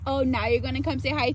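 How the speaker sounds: ordinary talk, nothing marked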